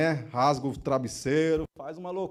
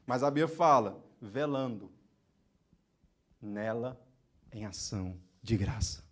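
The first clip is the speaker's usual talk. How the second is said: ordinary delivery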